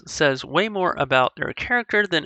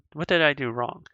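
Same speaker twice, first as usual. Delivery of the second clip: usual speaking voice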